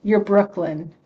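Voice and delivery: ordinary talk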